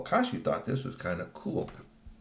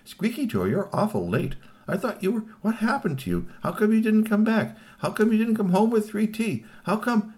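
high, insistent string of questions; pitched-up, pressing "where have you been" voice; puzzled questioning; 12 semitones higher than usual. Acted. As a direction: fret at the latecomer in a high voice. Imagined family questioning the returning toy.

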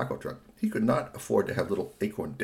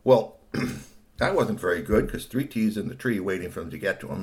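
plain reading voice

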